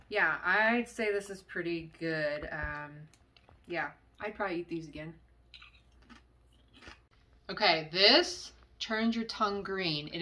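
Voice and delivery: talking while chewing; biting a crunchy chip; mouth full of chips. Real, not acted. Seen 4: chews between phrases, then bites a chip at the end.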